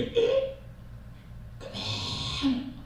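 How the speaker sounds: a whispered high 에? then a very slow breathy 그만; stunned disbelief. Acted. Saul seeing the boy David, stunned and whispering. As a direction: gasp in disbelief at such a boy.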